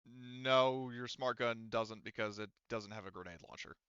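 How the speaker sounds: usual speaking voice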